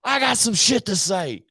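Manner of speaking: mock pissed-off truck rant. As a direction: kick off an angry rant like him. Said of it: "he says".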